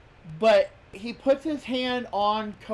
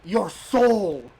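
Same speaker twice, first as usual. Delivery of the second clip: hammed-up villain; 5 dB louder than usual. Quoting The Rock as villain.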